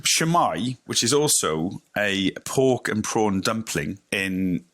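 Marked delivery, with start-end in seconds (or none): 0.0-0.8 Shwmae said as shoo-my, rising; Welsh greeting. Shown as a demonstration. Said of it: "I can teach you some Welsh".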